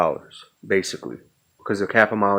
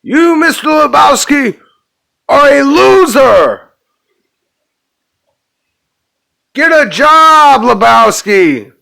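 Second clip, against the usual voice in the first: high, very loud, slow yell; drawn-out, acted shouting; hammed-up angry shout; 12 dB louder than usual. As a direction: bellow it like a film tycoon dressing someone down. Quoting The Big Lebowski.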